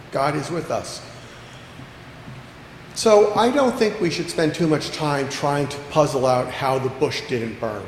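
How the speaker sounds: normal talking voice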